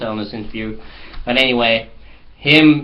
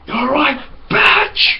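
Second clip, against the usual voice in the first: much higher and louder, shrieking mock rage; crazy flatmate yelling; 5 dB louder, much slower than usual. The flatmate ambushing Matthew outside, mock shriek. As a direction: screech it like a madman. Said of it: "he was like".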